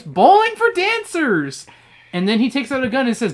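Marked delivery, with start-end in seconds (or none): 0.0-1.7 high, loud, drawn-out battle cry; exclaiming. Acted. Eddie rolling balls at the class, exclaiming loudly. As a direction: holler it slowly like a silly announcement.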